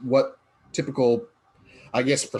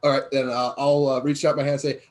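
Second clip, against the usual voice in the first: ordinary delivery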